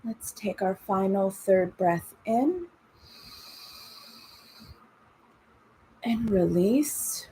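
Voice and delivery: calm, unhurried breathing guidance; guided meditation. Acted. Leads breathing and body scan.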